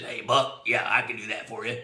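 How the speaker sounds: low-pitched, put-on character voice; Ray Mirsky impression. Manufacturer's rep agreeing to round up anglers, imitated in a low voice. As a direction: drop your voice low to imitate him. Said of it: "And he talked like this".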